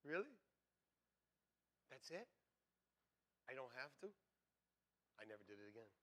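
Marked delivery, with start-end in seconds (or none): 0.0-4.1 soft, rising questions; retold quiet astonishment; quiet disbelief. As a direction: ask it in hushed, tentative wonder. Retold reaction to the voice.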